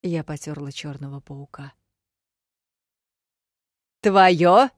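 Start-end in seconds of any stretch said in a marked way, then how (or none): 4.0-4.7 loud, angry one-word question; angry demand. A narrator's reading. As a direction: bark it at her, losing patience. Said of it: "уже зло потребовал". (злое требование)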